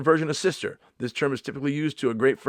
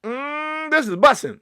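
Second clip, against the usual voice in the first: savoring, loud and high; mock foodie; 14 semitones higher, 7 dB louder, slower than usual. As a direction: pretend to taste something great. Mock tasting at steakhouse.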